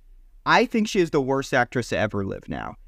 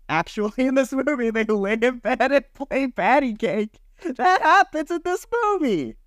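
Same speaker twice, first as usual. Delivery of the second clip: squeaky disbelief; 11 semitones higher than usual. Real, not acted. Telling friends about the bed scene, high squeaky disbelief.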